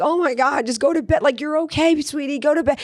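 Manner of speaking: high, exasperated coaxing; exhausted mom. Retold or imitated. Tired mom urging toddler to bed, high exasperated voice. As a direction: sigh it out like a worn-out mother at bedtime. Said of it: "you're an exhausted mom and you're like".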